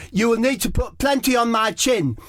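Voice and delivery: high, prim Harry Kane voice; Harry Kane instructing his teammate on sun cream, high and prim; Harry Kane impression. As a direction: instruct it fussily. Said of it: "Harry says".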